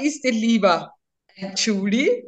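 ordinary talk

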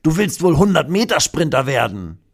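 höhere, frotzelnde Postbotenstimme; neckender Postbote. Acted. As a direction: zieh den Dino freundlich auf. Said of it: "sagt der Postbote und lacht". (höhere, neckende Postbotenstimme)

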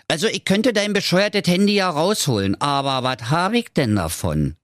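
high frog voice, easing down at the end; Frankie der Frosch. Acted. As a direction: play a haggling frog, pitched well above narration.

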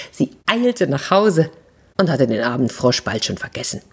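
plain reading voice

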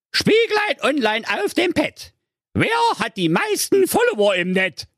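sehr hohe, keifende Hexenstimme; böse Direktorin. A narrator's reading. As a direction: fragt den Spiegel wie eine Hexe. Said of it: "fragte zur Beruhigung ihr iPad". (hohe Hexenstimme)

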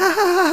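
silly laugh. Retold or imitated. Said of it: "hat so eine blöde Lache".

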